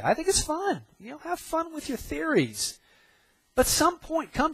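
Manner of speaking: preacher mocking physicists, high and breezy; mock cheerful. Real, not acted. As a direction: toss it off lightly, in a high voice. Mocking physicists' fun theories.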